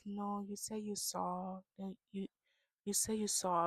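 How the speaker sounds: slow, very soft imitation; mock soft-spoken. Imitating Binda insisting on what was said, soft-spoken. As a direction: insist in a put-on hushed voice. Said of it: "they talk so soft".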